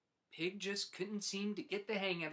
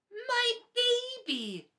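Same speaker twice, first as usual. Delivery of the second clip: very high, loud, drawn-out slow cry of joy; proud cry; 8 dB louder, much slower than usual. Acted. Pig sees his baby hatch and cries with pride. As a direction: burst with pride. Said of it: "cried Pig with pride".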